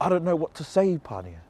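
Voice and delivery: high, fretful, then dropping away on the name; anxious backing off, trailing off; nervous protest. Retold or imitated. Replying to Pania that she has no words, high nervous fading.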